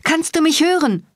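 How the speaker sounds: a piercing, very high shout. A narrator's reading. Cat screams into the static for Bones, frantic. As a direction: yell desperately over a bad line. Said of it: "schrie ich noch lauter".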